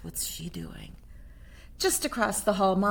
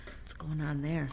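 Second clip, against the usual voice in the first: ordinary delivery, nothing marked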